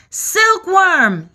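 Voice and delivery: high, loud call of a name; urgent high yell. A narrator's reading. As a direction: yell it across to the silkworm. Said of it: "yelled James".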